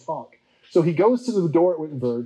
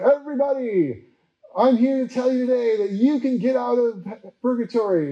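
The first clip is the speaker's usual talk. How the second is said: higher, hammed-up hawker pitch; street preacher calling out; 9 semitones higher than usual. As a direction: call the crowd in like a street-corner salesman.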